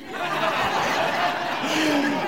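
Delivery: real high breathless laugh at his own joke. Real, not acted. Pastor laughing at his own joke, breathless. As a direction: crack up at the punchline. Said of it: "Oh, sorry".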